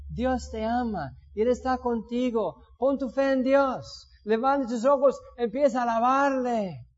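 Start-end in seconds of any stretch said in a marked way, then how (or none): none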